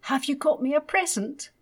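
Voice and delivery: put-on eager child. A narrator's reading. Child asks parents for present.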